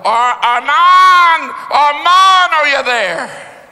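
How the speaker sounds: long, high, loud calls stretched out slowly; calling out. Retold or imitated. Father calling his buried son, loud drawn-out call. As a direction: call a lost child's name into the rubble. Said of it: "he kept calling his son".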